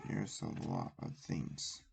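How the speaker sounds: breathy whisper, no voice. Acted. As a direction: whisper it to yourself. Same line whispered then repeated.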